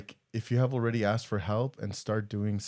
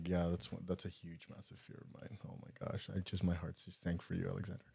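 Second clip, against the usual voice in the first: very soft and low, fading into a near whisper; hushed sympathy; 5 semitones lower, 16 dB quieter than usual. Real, not acted. Co-host reacting to a father's lost son, hushed sympathy. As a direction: barely voice it, heart sinking. Moved by listener's bereaved-parent comment.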